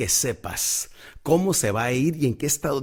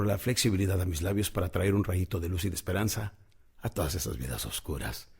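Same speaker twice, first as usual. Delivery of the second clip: softer, hushed and prayerful; quiet prayer; 5 semitones lower, 4 dB quieter than usual. Retold or imitated. Speaker sharing his pre-talk prayer, praying in a hushed voice. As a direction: pray it quietly to the Lord, inward. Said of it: "esa oración viene siendo".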